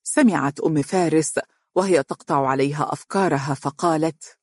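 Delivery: usual speaking voice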